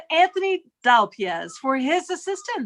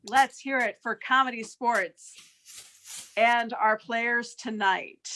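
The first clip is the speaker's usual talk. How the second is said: usual speaking voice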